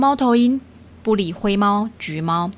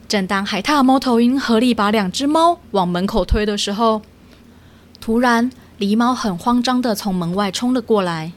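usual speaking voice

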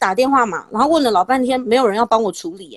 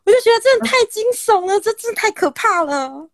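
shaken; 10 semitones higher than usual. Real, not acted. Reliving the scare.

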